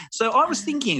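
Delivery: high-pitched New Zealand director; New Zealand accent. Retold or imitated. Director approaching her actor, mock New Zealand accent. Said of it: "she's from New Zealand".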